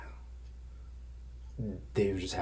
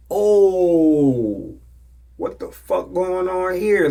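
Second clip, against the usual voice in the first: loud, high drawn-out disbelief; mock shock; 11 semitones higher, 13 dB louder, much slower than usual. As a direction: stretch out an alarmed, hammed-up reaction. Retelling his shock at the grabbing.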